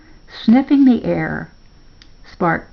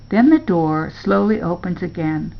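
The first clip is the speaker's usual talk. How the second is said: plain reading voice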